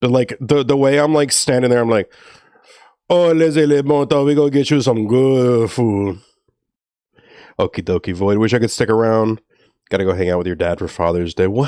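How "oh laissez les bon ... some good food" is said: lightly put-on Creole accent, lilting French phrase; a playful hint of a Louisiana Creole accent. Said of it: "my Creole accent was like".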